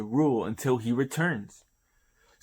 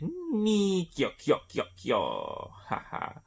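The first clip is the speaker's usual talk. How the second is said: creepy cackle; 8 semitones higher, slower than usual. Retold or imitated. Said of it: "he's like".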